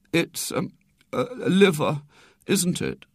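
tentative. A narrator's reading. Boy guessing at his illness to his sister, tentative. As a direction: feel for the word, unsure. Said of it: "said William tentatively".

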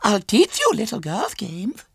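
put-on very high little girl voice; Violet Elizabeth. A narrator's reading. Small girl questions her visitor, high and childish.

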